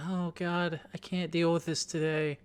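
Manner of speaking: soft, resigned groan to oneself; mock inner despair. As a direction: softly plead with yourself in mild despair. Acted dismayed inner voice.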